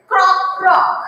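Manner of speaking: put-on playful frog croak for children. Shown as a demonstration. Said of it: "he only said".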